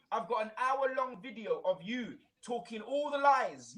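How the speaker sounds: ordinary delivery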